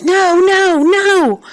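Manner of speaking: high, loud terrified screams of no. Acted. Beaten boy screams at the policeman standing over him. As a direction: scream it in terror, pitch way up. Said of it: "I screamed".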